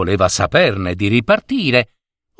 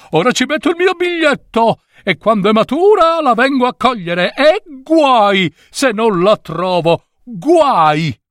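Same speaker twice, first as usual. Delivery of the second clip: triumphant cry; 10 semitones higher than usual. A narrator's reading. Passenger claims an unripe strawberry, triumphant high cry. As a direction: call out a proud claim, then warn off rivals. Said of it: "e gridava".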